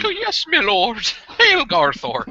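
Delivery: high-pitched mock Irish hobgoblin; hammed-up Irish accent from a fawning servant. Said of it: "shitty Irish accents".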